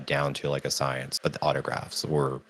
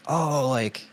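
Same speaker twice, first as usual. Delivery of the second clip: much higher gushing mock voice; imitating Frodo actor; 11 semitones higher than usual. Fan mimics Elijah admiring her poster in a high gushing voice. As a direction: gush high about the poster like an excited fan. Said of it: "He was like".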